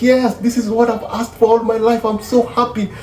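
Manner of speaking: put-on high-pitched happy girlfriend voice; voicing the girlfriend. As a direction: play her as sweetly delighted. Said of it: "she goes like".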